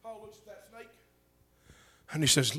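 ordinary delivery

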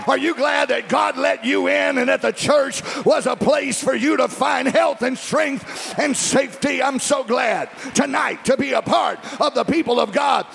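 high, shouted revival preaching; full-force preaching. Real, not acted.

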